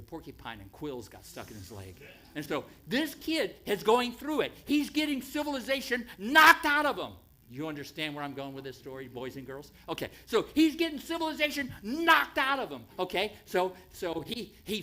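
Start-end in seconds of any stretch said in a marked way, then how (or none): 2.3-7.1 loud, high preaching emphasis; emphatic point. Real, not acted. Preacher drives home his point. 9.9-12.8 loud, high preaching emphasis; emphatic point. Real, not acted.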